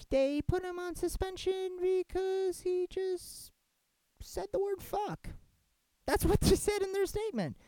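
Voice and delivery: can you believe it, stretched and high; ranting viewer stunned by the suspension reason; incredulous. Real, not acted. Incredulous outrage.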